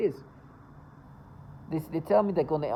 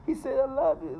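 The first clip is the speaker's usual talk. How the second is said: high-pitched put-on tearful voice; pretend crying; 11 semitones higher than usual. Accuser framing a man, making herself cry. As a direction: fake a weepy accusation. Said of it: "Having to make myself cry".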